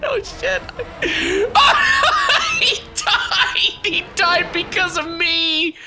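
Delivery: high, cracking laughing shriek. Real, not acted. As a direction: squeal through laughter at the death. His character gets killed.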